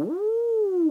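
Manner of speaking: high, drawn-out ooh; mock suspenseful ooh; intrigued ooh. Reviewer acting out viewer at suspenseful moment, intrigued. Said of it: "kinda make you go".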